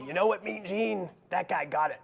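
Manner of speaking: lightly done wrestling promo growl; a hint of Hulk Hogan hollering at Mean Gene; Hulk Hogan impression. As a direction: hype it like a wrestler on the mic. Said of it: "a Hulk Hogan impression".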